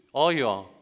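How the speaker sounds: loud, punched imperative; emphatic command. Shown as a demonstration. Preacher tells the whole congregation to obey, loud emphatic command. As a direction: drive it home as an order.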